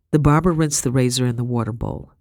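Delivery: usual speaking voice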